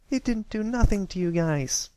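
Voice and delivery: put-on high, loud child protesting; shouting girl. A narrator's reading. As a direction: shout up high in defence of a friend. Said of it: "Apple Bloom shouted".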